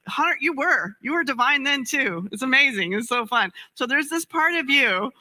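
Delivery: ordinary talk, nothing marked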